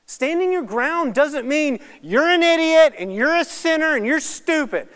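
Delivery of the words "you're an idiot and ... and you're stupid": high, loud, hammed-up name-calling; mock condemning. Believer berates others for their sins, mock condemning. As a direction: imitate someone hurling judgement.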